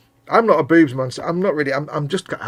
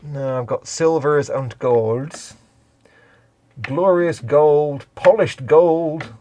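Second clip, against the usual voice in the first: slow, drawn-out names; much slower than usual. Pretend. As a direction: savour each word slowly.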